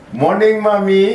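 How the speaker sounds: put-on Indian accent. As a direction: greet mum in an Indian accent. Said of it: "So if you're Indian, you're going".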